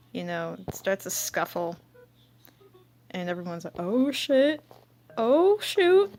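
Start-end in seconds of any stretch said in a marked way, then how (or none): 3.8-6.1 high, loud startled gasp of a crowd; shocked onlookers. Retold or imitated. Classmates watching a fight break out, high shocked cry. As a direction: voice shooting up in alarm. Bystanders react to a school scuffle.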